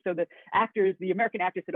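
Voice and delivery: usual speaking voice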